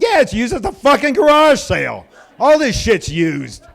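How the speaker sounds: loud, high comic outrage; exasperated retort. Retold or imitated. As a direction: snap back, worked up. Retold angry retort to husband.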